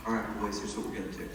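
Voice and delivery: low, hushed imitation of dad; scheming dad. Dad briefing his boys on a plan, low and quiet. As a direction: lay out a plan in a low, soft voice. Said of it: "my dad has this genius idea".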